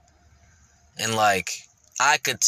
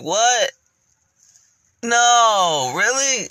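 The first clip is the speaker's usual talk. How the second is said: high, loud disbelieving "what, no, really"; hammed-up shock at the accusation; incredulous what; 12 semitones higher, 5 dB louder, much slower than usual. Retelling shock at being called bully.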